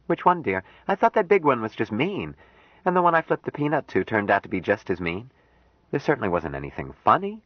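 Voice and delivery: Jill, puzzled. Acted. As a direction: object that nothing was funny. Jill voiced by narrator.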